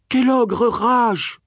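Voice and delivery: put-on high, slow, worried child voice; a small girl fretting at the thunder; little girl voice. Little girl asks in storm.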